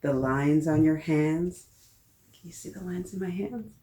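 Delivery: normal talking voice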